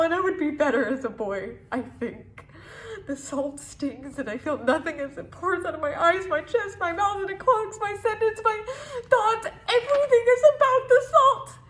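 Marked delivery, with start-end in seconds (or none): 4.2-11.6 building from speaking voice to high, loud cry; anguished climax. Acted. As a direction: escalate breathlessly until it bursts out. Poem's climax, everything overflows.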